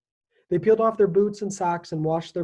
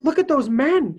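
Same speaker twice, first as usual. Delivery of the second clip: high, loud excited cry; a girl's loud excited cry; cried out; 9 semitones higher, 8 dB louder than usual. A narrator's reading. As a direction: call it out, thrilled to see them. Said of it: "she cried".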